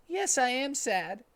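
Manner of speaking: high, slow mopey fox; Tails voice. Acted. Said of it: "said Tails to Sonic's mom".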